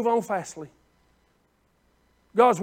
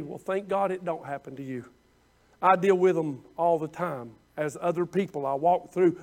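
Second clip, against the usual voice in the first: ordinary talk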